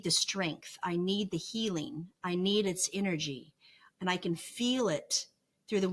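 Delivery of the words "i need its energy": usual speaking voice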